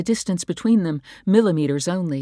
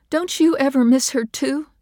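higher, louder accusing question; fierce; 10 semitones higher, 7 dB louder than usual. A narrator's reading. Wife confronting husband about their lost daughter, fierce. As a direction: press him hard. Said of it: "fierce now".

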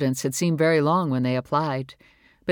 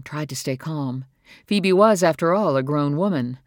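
ordinary delivery, nothing marked

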